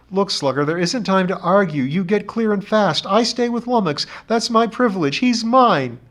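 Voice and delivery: put-on firm young man, insisting. A narrator's reading. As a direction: voice the boy, stubborn and protective. John Thomas insists.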